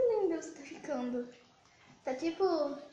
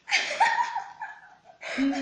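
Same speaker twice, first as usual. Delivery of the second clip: giggling high and loud; high-pitched laugh; 6 dB louder than usual. Real, not acted. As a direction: burst out laughing. Laughing at the friend's face.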